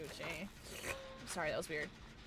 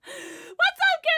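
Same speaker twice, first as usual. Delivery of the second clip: excited squeal; 8 dB louder than usual. Real, not acted. Greeting chat, squealed.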